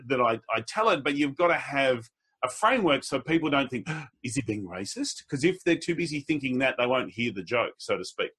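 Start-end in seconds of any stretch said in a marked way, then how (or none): 3.9-5.3 hushed mock gasp of alarm; gasp, then murmur it low as if scandalised; mock shocked whisper-aside. Audience member doubting the comedian, hushed mock shock.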